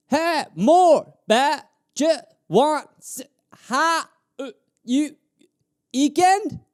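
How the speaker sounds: mock chopping every word short; clipped words. Shown as a demonstration. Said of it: "doing that with everything I say".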